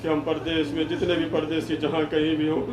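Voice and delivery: low, soft congregational dua; supplicatory prayer. Real, not acted. Leads closing supplication. (دھیمی، نیچی آواز میں عاجزانہ دعا)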